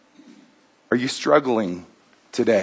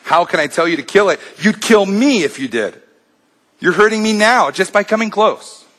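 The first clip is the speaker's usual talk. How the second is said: high, loud, rushed cry of pain; pained yell; 7 semitones higher, 4 dB louder than usual. A narrator's reading. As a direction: yell as if burned. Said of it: "and the man yells".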